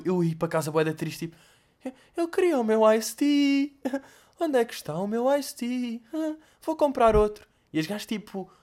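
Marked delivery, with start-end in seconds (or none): 1.8-7.5 put-on whiny little kid. Crying child voiced in story.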